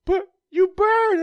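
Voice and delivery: high, slow squeaky retort; mock high voice. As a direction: drag it out in a silly squeak. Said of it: "I'm like".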